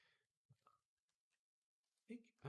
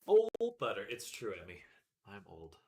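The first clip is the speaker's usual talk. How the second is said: put-on old man voice; 3 semitones lower, 13 dB louder than usual. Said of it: "That's my old man voice".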